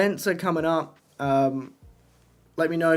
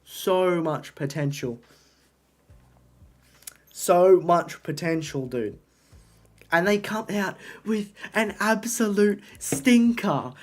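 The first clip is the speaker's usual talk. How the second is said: mock British accent; 5 semitones higher than usual. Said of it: "judge my least favorite livery in a British accent".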